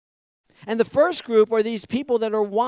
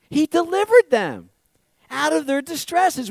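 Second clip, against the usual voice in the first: higher and louder, hit delivered; loud preaching proclamation; emphatic declaration; 8 semitones higher, 7 dB louder than usual. Real, not acted. Correcting the mock claim.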